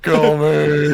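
high, loud mock plea; imitated desperate yell. Co-host imitating the dying man with the pistol, mock yell. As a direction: yell it like a doomed movie victim. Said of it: "He's like".

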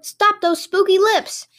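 high, drawn-out chasing yell. A narrator's reading. Townspeople chase the runaway thief, high drawn-out yell. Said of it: "they yelled".

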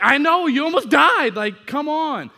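exasperated yell. Retold or imitated. Dad still shaken by his hurt daughter, yelling in exasperation. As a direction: scold her, still shaken.

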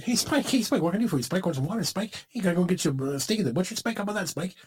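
high, rapid-fire little sidekick dog; hammed-up fawning small-dog impression; yappy cartoon chihuahua. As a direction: pester the big bulldog in an eager, high voice. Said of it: "the Chihuahua that walks down the street".

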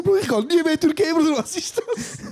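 very high, squeaky mock panic, slowing at the end; shocked. Grandson freaks out at the slaughter joke, high and squeaky. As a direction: shriek it in disbelief. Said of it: "ich so".

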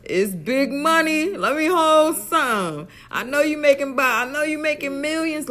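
put-on loud, high country accent, slowed down. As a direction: beg for money in a country accent. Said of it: "let me get my country accent".

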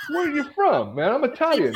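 high-pitched mock insistence; hammed-up retold character; imitated proud Italian guy. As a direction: press the question in a high put-on voice. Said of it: "he's like".

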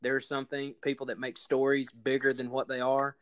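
ordinary talk

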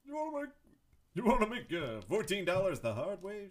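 high, brash comic voice; Rodney Dangerfield. Retold or imitated. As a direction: do a quick Dangerfield bit. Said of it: "Maybe 'cause of Rodney Dangerfield".

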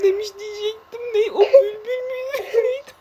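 high, laughing confusion; squeaky through giggles; after laughing hard. Real, not acted. Realizes mistake while laughing.

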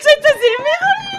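high, loud squeal of delight; thrilled cry to the friends she missed; overjoyed shout. Real, not acted. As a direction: shriek it with pure joy.

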